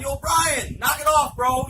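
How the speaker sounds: high, loud shout; yelling. Retold or imitated. Boys calling to a friend they think is messing with them, yelling. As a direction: call out sharply to a prankster. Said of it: "we're yelling".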